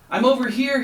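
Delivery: high, loud yell. A narrator's reading. Frog yells to the boat, high loud yell. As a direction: yell back through the rain. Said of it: "he yelled".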